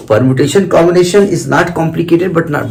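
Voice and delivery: ordinary talk, nothing marked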